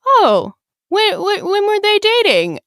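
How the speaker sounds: high, bright mock-innocent voice; mock casual. Friend voicing Olive's sly question to Ethan, high and mock-casual. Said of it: "pulls a power move on him and is, like, very casual".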